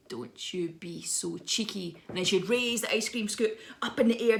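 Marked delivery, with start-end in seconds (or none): 0.1-2.0 slow, quiet warning from a dinner lady; menacing dinner lady. Acted. Dinner lady warning a cheeky child, slow quiet menace. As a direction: scold slowly with hushed menace. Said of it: "I am going to become a dinner lady".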